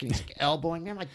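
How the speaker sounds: normal talking voice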